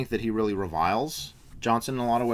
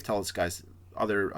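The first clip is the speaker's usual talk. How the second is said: usual speaking voice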